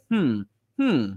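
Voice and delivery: drawn-out, falling "hmm"; musing "hmm, hmm"; pondering hum. Acted. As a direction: hum doubtfully, as if weighing it up. Voicing people's wary second thoughts.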